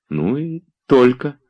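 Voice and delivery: high, slow, drifting off; absent-minded. A narrator's reading. Olga answering Oblomov distractedly, slow and high. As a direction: answer while looking elsewhere. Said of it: "рассеянно добавила она".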